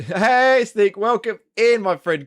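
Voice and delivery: high, loud greeting with a laugh going in; excited welcome. Real, not acted. Streamer greeting a viewer joining the stream, excited welcome.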